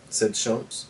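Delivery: usual speaking voice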